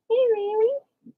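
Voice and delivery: high cooing hello; pet talk. Real, not acted. Host greets the cat mid-reading, high cooing pet talk.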